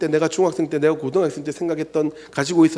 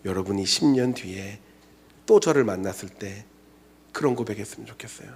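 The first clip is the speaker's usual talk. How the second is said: tender reassurance; 6 semitones lower, 5 dB quieter than usual. Acted. Tender preaching.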